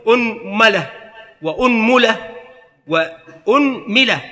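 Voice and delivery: أنملة with damma on the hamza, mim a, u, i; vowel variants. Shown as a demonstration. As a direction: say أنملة as unmala, unmula, unmila, the last slow. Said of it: "وفي الميم ثلاثة أوجه".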